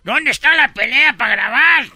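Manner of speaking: high excited calls; eager crowd. Retold or imitated. Bystanders eagerly asking where the fight is, high and excited. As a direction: crowd rushing in eager to film. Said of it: "Y todos".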